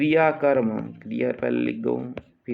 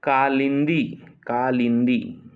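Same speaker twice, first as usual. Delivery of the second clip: usual speaking voice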